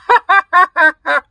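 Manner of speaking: high cackling laugh; witch cackle. A narrator's reading.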